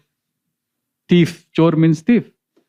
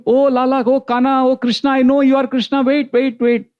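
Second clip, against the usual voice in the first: high longing call after someone running away; calling after Krishna; 9 semitones higher than usual. Acted. Blind Bilamangal calls after the fleeing boy, high yearning plea. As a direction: cry out wait, pitched up and yearning.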